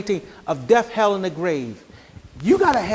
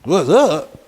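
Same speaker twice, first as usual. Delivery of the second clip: lower, loud, casual street greeting; laid-back what's up; 4 semitones lower, 5 dB louder than usual. Retold or imitated. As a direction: toss off a cool greeting. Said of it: "I'm like".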